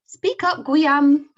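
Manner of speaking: high loud French teacher; stern French teacher; French accent. Acted. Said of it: "French teacher".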